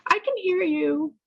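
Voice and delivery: put-on high, loud, blurting young woman; a stranger calling out what she overheard; young woman's voice. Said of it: "and she goes".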